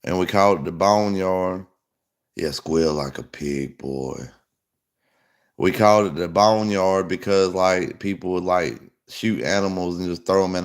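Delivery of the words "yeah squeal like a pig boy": low, slow menacing drawl; menacing hillbilly. Retold or imitated. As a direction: drag it out low like a backwoods creep. Quoting hillbilly menace line.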